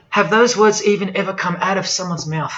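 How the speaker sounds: normal talking voice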